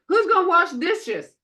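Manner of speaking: high, lively question; parent asking chores. Retold or imitated. Grandmother calling the kids to wash dishes, high and lively. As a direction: call out to the kids. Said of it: "Y'all say".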